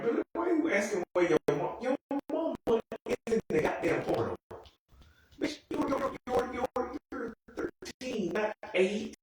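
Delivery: high-pitched, incredulous telling-off; exasperated scolding. Acted. Viewer scolding a crying thirteen-year-old character, exasperated. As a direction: scold in a squeaky, disbelieving voice.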